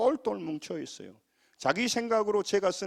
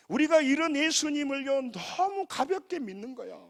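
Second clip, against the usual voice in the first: ordinary delivery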